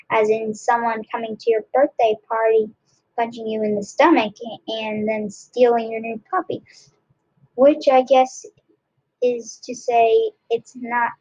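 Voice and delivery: ordinary delivery, nothing marked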